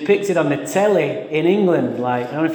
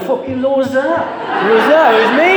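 mock shock at hearing himself laugh; startled at own laugh; 8 semitones higher, faster than usual. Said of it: "I went".

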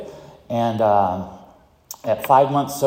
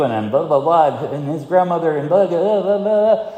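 rising whiny blah-blah, higher and louder; mock whining; 7 semitones higher, 4 dB louder than usual. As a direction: mimic your own whining, climbing higher. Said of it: "I'm whining".

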